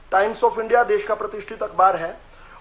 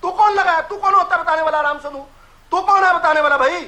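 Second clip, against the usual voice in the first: much higher, heated retort; angry shout; 5 semitones higher than usual. Real, not acted. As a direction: put him in his place. Rival told him speak calmly.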